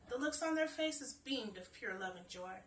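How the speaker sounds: ordinary delivery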